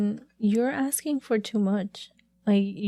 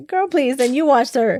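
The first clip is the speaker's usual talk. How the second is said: sassy, high and loud; 4 semitones higher, 5 dB louder than usual. Real, not acted. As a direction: brush them off with attitude. Mocking the other moms.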